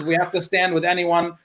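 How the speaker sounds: ordinary talk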